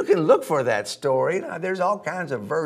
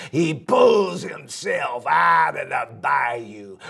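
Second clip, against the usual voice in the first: ordinary delivery, nothing marked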